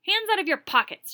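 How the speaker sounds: high, loud, sharp snap. Acted. Teacher at the boy standing by her desk, sharp high snap. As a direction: bite off the order. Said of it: "she snapped".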